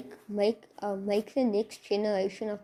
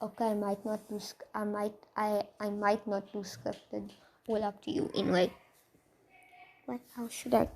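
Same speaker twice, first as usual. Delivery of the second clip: plain reading voice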